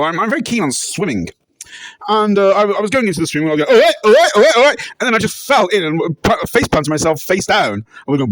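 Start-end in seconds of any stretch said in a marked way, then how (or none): 3.7-4.9 loud, high entertainer's holler; hammed-up high repeated shout; shouted catchphrase. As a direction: belt it out like a TV host's catchphrase.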